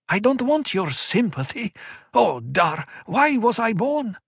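high, urgent, pleading; desperate insistence; anguished young woman. Acted. Murbella despairs.

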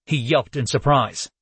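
usual speaking voice

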